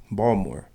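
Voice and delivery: Baltimore said as "Bawlmer", T and I dropped; local pronunciation. Shown as a demonstration. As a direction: say Baltimore like a native, without T or I. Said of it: "The T and the I are removed when a native speaks".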